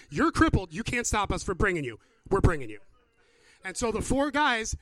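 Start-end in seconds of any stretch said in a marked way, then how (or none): 0.1-2.8 loud, emphatic overruling; forceful, no-arguments retort; insistent friends. Acted. Acted friends overrule the cripple.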